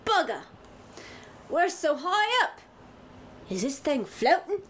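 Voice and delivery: high, amazed Cockney voice; a Cockney lad gawping at the height; Cockney accent. Acted. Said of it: "what kind of accent I'm gonna do".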